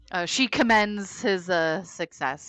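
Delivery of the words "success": ordinary talk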